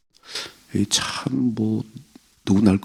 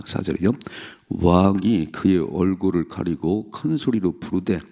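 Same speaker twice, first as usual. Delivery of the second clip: ordinary delivery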